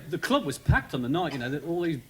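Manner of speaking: ordinary talk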